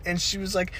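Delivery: ordinary talk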